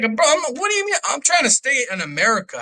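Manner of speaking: high-pitched outraged protest; indignant disbelief. Retold or imitated. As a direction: push back, stunned he'd say that. Outraged reply to the officer.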